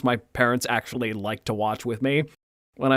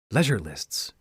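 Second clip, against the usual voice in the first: sultry narrator; 4 semitones lower, 4 dB quieter, slower than usual. Acted. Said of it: "which is why I'm speaking like a s-, sultry".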